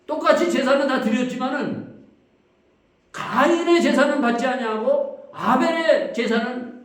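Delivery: preaching at full force. Real, not acted. Preacher pressing the congregation about Cain and Abel, loud and fervent.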